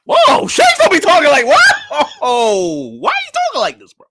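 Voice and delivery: high, loud incredulous cries; shocked outburst. Real, not acted. Streamer shocked by how Shanks talks, incredulous yelling. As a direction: yell in total disbelief.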